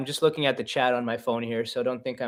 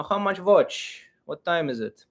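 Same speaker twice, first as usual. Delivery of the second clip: Slavic accent; 2 semitones higher than usual. Shown as a demonstration. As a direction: play it in an Uncle Ivan-style Slavic accent. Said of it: "using the accent".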